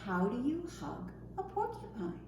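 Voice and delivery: usual speaking voice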